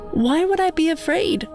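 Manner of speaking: ordinary delivery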